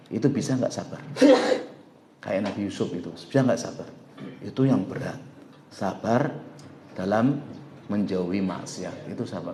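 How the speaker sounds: dropping low and soft, confiding; quiet earnest. Acted. Preacher confiding to congregation about temptation, hushed.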